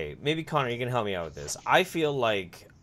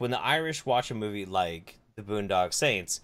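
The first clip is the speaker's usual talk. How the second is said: normal talking voice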